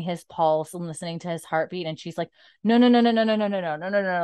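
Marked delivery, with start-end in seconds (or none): 2.6-4.2 rapid string of pleading, high and loud then easing; retold panic, tumbling out; mock frantic. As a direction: imitate a desperate denial. Retold panic at dying husband.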